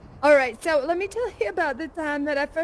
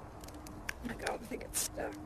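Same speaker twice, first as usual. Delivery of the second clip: strained, voiceless and hushed; straining; 3 semitones lower, 16 dB quieter than usual. Acted. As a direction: squeeze the words out while pulling. Straining to pull something loose.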